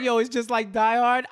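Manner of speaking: hyped-up naive delight; mock excitement. Retelling Trace's line to co-host, mock excited.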